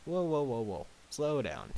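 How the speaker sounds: loud, high start, easing down; halting interjection. Acted. Applejack cuts short Twilight's rushed account, halting her. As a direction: rein her in firmly.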